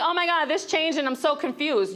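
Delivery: mocking whiny person. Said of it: "people are like".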